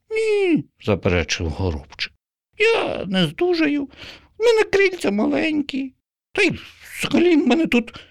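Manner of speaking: very high, timid sparrow; hesitant little excuses. Acted. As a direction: sparrow shyly explaining.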